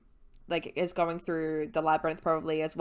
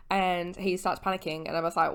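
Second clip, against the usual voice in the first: ordinary talk, nothing marked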